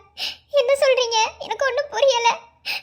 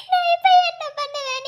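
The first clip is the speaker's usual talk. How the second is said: high loud crying cry; wailing lament; despairing wail; 4 semitones higher, 4 dB louder than usual. Acted. Abandoned woman despairs.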